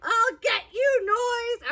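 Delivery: high, hammed-up fury; mock angry Owl. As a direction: threaten the noise like a cartoon. Owl attacking walls.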